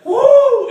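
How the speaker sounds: very high, loud woo; wild acted holler; rodeo whoop. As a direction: whoop as the animal bucks. Riding a bucking dog.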